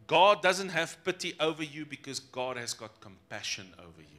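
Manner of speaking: ordinary talk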